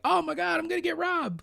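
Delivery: high-pitched pretend fright; hammed-up high worry; mock panic. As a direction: squeal it like a panicky traveler. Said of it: "You're not like".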